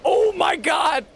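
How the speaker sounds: high, loud shocked yell; real startled outburst; shocked shout. Real, not acted. Reacting to game on screen.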